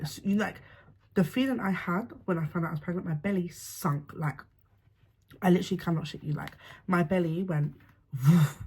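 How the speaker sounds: ordinary talk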